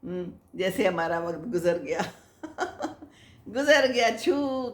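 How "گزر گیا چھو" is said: laughing. Real, not acted. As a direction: let the laugh carry گزر گیا. Laughing at time slipping away. (ہنستے ہوئے)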